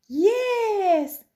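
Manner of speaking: very high, loud cheer; delighted pirates shouting; shouted. A narrator's reading. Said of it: "shouted the pirates".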